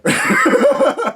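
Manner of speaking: squealing laugh at the punchline; high burst of laughter. Real, not acted. Laughing at himself for asking the wrong man, squealing laugh.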